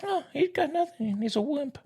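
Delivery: high, airy mock put-down of a slugger; mock scorn. Co-host mocks Ohtani as a wimp, high ironic scorn. Mocking the star hitter ironically.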